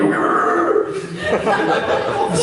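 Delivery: high electrocuted goblin screech; wordless shriek of a goblin being zapped; goblin scream. Retold or imitated. Electrocuted goblin in a game, screaming.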